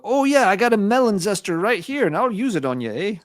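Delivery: put-on menacing in a cheery Canadian accent. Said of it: "I'm doing a Canadian accent for Swedes".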